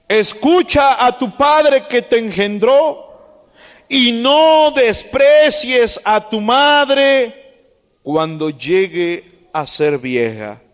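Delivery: slow, solemn scripture read. Acted. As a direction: read the verse out to the flock. Lee Proverbios en voz alta. (lectura solemne y pausada de la Escritura)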